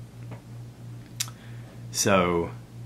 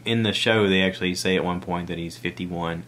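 normal talking voice

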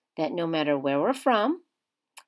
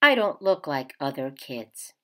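gentle picture-book read-aloud; reading to children. A narrator's reading. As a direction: read it like story time for kids. Teacher reads picture book aloud.

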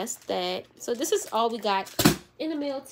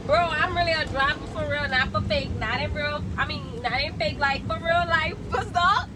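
excited; 5 semitones higher, 5 dB louder than usual. Real, not acted. Thrilled driving big truck.

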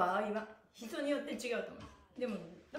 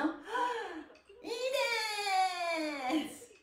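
strained laugh; 8 semitones higher, much slower than usual. Real, not acted. Straining in a hard pose.

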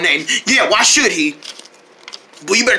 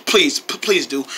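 ordinary talk, nothing marked

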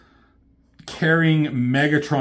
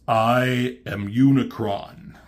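Unicron voice; much slower than usual. Retold or imitated. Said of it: "He says".

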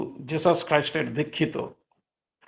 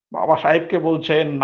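ordinary talk

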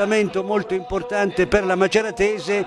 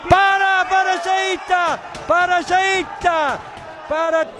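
much higher, slow excited cry; breathless italian goal commentary; 11 semitones higher, slower than usual. Real, not acted. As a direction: cry out the save in joy. Save by goalkeeper.

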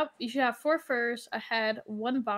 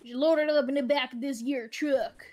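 mock farmer accent; put-on country accent; 2 semitones higher, 4 dB louder than usual. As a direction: lean into a yokel accent. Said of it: "We're talking accents".

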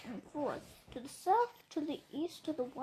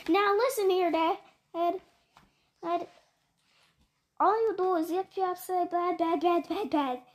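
angry yell; 5 dB louder than usual. Acted. Once-ler yells back at the Lorax, loud and defiant. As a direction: shout him down, rising in defiance. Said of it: "Then I got mad, terribly mad. I yelled at the Lorax".

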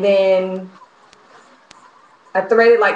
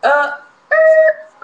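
high, loud exasperated squeal; a strangled squeak of real frustration; frustrated squeal; 5 dB louder than usual. Real, not acted. Stuck on her sew-in, she lets out a high frustrated squeal.

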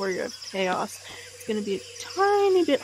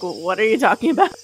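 confused; 8 dB louder, much faster than usual. Pretend. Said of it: "I'm just gonna look at him confused".

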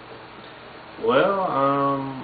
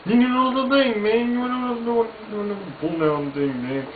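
much higher, rambling Boomhauer voice; Boomhauer impression; 12 semitones higher than usual. Vlogger rambles as Boomhauer, much higher. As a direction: trail off like Boomhauer, pitched way up. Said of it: "And then Boomhauer".

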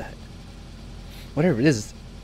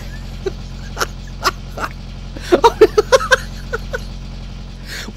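long wheezing laugh breaking into high cackles; real fit of laughter; helpless laughter. Real, not acted. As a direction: laugh breathlessly, then burst out high. Laughing at the crash.

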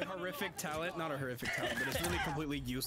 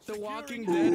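ordinary talk

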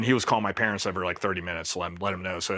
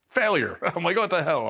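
higher-pitched mock disgust; officer scoffing when the promised team doesn't exist; exasperated; 7 semitones higher than usual. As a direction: throw up your hands in disbelief. Exasperated reaction retold.

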